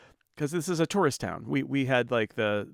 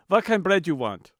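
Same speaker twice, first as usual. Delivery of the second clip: put-on louder, higher grumpy Hungarian accent; 5 semitones higher, 5 dB louder than usual. Grumpy owner to a customer, gruff Hungarian accent. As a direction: demand it in a thick Hungarian accent. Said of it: "in his Hungarian accent".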